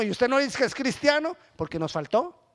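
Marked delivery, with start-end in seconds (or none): 0.0-1.4 accusing neighbor. Retold or imitated. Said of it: "o el vecino".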